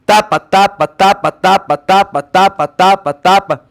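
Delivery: even, punched repetition; steady staccato drill; rhythmic repetition demo. Shown as a demonstration. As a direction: chant it steadily, nose pinched on and off. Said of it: "com o nariz preso ou não".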